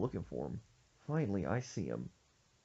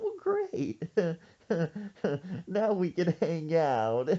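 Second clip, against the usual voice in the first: forced cheer; 8 semitones higher, 5 dB louder, slower than usual. Retold or imitated. Forced cheer to unwanted kid.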